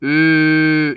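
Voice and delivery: 으 as a long rounded "ü", no glide; steady, sustained front rounded vowel on 으; pure monophthong. Shown as a demonstration. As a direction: hold 으 with fixed rounded lips. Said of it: "이렇게 발음해야 돼요".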